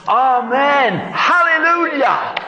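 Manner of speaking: amen, hallelujah shouted very high; joyful shout. Shown as a demonstration. Leading the congregation together, a joyful shout. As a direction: shout it to the rafters. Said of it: "Let's say it all together".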